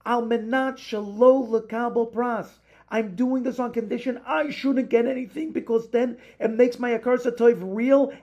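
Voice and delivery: high, loud, fervent plea; rising fervor of a grateful heart; impassioned. Acted. Passionate climax of the lesson.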